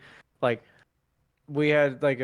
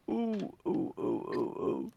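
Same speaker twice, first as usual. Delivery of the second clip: slow breathy sleeping moans; drawn-out groggy oohs after scratching the nose; sleepy moans; 10 semitones higher, 8 dB quieter, slower than usual. Retold or imitated. Mom retells his sleep noises, slow sleepy moaning. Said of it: "And she was like".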